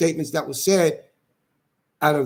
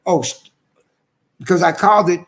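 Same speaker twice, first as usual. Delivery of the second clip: ordinary talk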